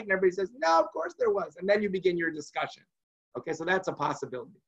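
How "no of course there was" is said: high insistent "No"; objecting table. Acted. The guests at the meal insisting of course it was different. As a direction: everyone pushing back at once. Everybody says.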